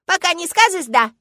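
put-on high, loud little-girl ultimatum; demanding child shout. A narrator's reading. Girl forcing father to say yes, demanding. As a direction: insist on it at full volume.

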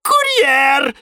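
high, loud glad cry; delighted shout. A narrator's reading. Flytrap thinking the noise means a courier, gleeful shout. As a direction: call out happily as if a delivery came. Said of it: "обрадовался Венерина мухоловка Жорж".

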